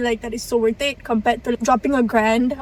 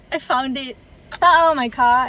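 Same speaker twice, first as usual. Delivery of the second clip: ordinary delivery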